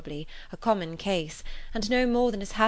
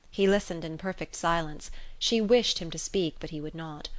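ordinary delivery, nothing marked

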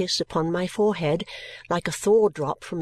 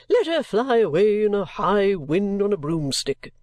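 indignant gentleman; 3 dB louder than usual. Acted. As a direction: fling out the curse as an eccentric gentleman. Gentleman curses absent woman.

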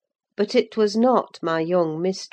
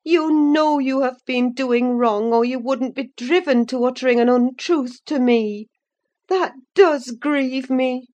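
higher-pitched, grieved scolding; sorrowful reproach; 7 semitones higher, 4 dB louder than usual. A narrator's reading. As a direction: plead with her, pained by the lie. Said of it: "I cried sorrowfully".

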